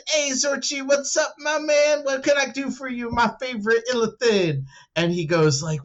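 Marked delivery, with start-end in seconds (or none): 0.1-4.6 eager greeting. Retold or imitated. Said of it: "I said".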